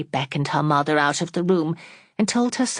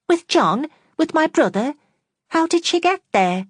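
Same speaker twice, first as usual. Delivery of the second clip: shocked; 13 semitones higher, 4 dB louder than usual. Acted. Fanny shocked by the news.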